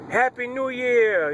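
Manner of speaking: high, drawn-out celebratory call; New Year cheer. Retold or imitated. Party crowd greeting the new year, drawn-out cheer. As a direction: stretch it out like a crowd cheering midnight. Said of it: "now they're counting down".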